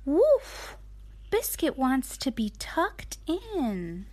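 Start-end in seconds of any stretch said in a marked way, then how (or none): 0.1-0.8 high, loud playful woof; puppy barks. Acted. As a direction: yip like a little puppy. Mom voices the puppy.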